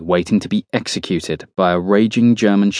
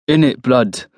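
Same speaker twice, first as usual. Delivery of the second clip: mock-serious street slang, pitched up; teasing, pitched-up mimicry; mock seriousness; 4 semitones higher than usual. A narrator's reading. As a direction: echo the slang with pretend gravity. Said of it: "Cookie nods in mock seriousness".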